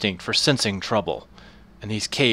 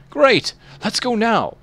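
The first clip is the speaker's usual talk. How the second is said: high, loud eager cry; eager blacksmith; 11 semitones higher, 4 dB louder, slower than usual. Acted. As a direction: burst out with relief.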